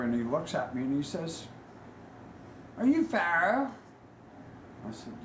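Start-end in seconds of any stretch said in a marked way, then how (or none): 2.8-3.7 high, loud British voice; British accent, perky rising question. Retold or imitated. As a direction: mimic a little British man, pitched high. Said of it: "a little British man walks up".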